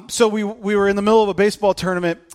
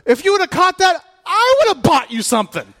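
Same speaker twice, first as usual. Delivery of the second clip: high, loud teasing call; opposing coach yelling at the boy; mock opposing coach shout; 10 semitones higher, 6 dB louder than usual. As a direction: heckle across the field. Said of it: "turns around and goes".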